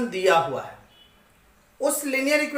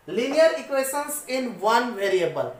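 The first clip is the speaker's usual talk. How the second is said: slow topic title; much slower than usual. Shown as a demonstration. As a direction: read the title very slowly, word by word. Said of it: "teacher announces the topic". (अध्याय का नाम बहुत धीरे-धीरे)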